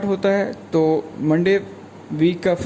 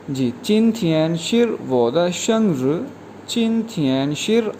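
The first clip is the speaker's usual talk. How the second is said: usual speaking voice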